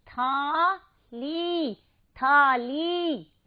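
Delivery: थाली very slow, both syllables stretched; long ī ending. Shown as a demonstration. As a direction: draw out the ली of थाली. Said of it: "इसको ऐसे ही उच्चारित कीजिएगा".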